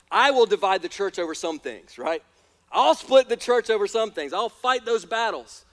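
loud, firm preaching; rally speech. Acted. Preacher declares firm stand.

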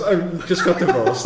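lightly voiced, high, laughing plea; sad boy band member. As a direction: play a washed-up boy band singer half laughing. Mock boy band member pleading.

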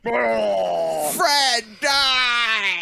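loud monster yell; bigfoot grunting. Pretend. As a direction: bellow it like Bigfoot. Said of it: "what is a good Bigfoot voice?".

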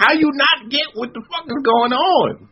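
high voice, sustained; exasperated high mock voice; imitating Luke Cage. Luke Cage confronts Tony about illegality, high and exasperated.

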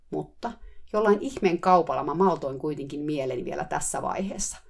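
plain reading voice

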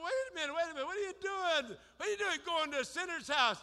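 fast, high grumbling crowd; mock murmuring crowd. Townspeople grumbling at Jesus, lightly voiced mock outrage. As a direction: rattle it off like gossiping onlookers. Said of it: "They murmured and said".

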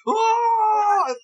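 high, loud screaming "Ah!"; rock-show crowd roar. Retold or imitated. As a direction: scream like a whole crowd going wild. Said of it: "And then everybody's like".

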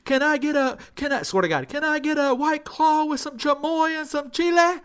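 high, sing-out imitation of a cheery regular; Corey ordering drinks. Corey orders, voiced in story.

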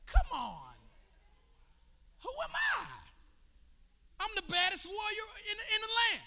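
high, indignant boasting as a mighty warrior; proud warrior boast. Acted. Naaman insulted that the prophet sent a servant, boastful high voice. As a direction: puff up like the baddest warrior in the land.

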